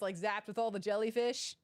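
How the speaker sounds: ordinary delivery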